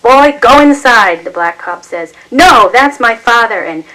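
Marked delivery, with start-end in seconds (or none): 0.0-1.2 louder, slow, higher command; stern shouted order. A narrator's reading. Cop orders son inside. 2.3-3.6 higher, louder, slow outcry; loud desperate protest. A narrator's reading. Son shouting at police about his father, desperate protest.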